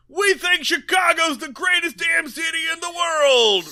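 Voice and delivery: high, loud arena shout at the crowd; mock rock-band yell. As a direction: bellow it like a frontman hyping the city. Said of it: "a rock band yelling".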